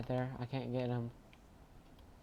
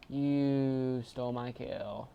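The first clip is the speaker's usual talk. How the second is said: normal talking voice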